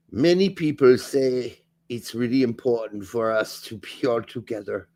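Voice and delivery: put-on lightly German-accented coach quote. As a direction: read it like Pochettino, German accent. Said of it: "your German accent for Poach".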